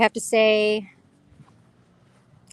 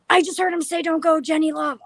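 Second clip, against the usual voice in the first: shocked; 8 semitones higher than usual. Retold or imitated. Husband shocked by the recording.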